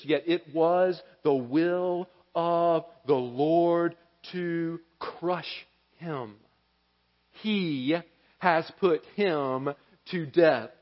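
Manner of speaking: slow, soft, weighty reading of the verse; hushed, slow preacher reading scripture; solemn scripture reading. Acted. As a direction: let each phrase land slowly.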